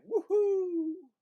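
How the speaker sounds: high, loud, joyful cheer; gleeful whoop. Acted. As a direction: whoop like you're flying through the air. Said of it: "the Benjamin as the dragon flies him home, going".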